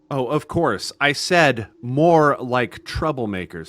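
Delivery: loud, high, exaggerated repeat; irritated father projecting every word; speaking up pointedly. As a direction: repeat it loudly for someone who claims not to hear. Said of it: "Can you please speak up?".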